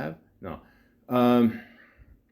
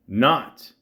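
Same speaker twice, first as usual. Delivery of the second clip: "Not" punched loud like bold caps; 2 semitones higher, 5 dB louder than usual. Retold or imitated. Reading her dating profile aloud, with "Not" punched loud. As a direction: hit "Not" hard and loud, as if written in capitals. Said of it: "in bold caps".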